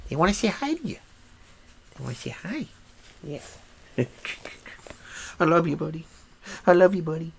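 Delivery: high, soft cooing pet voice. Real, not acted. Cooing at his dog Nugget, high and soft baby talk. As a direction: coo at your puppy in a sing-song.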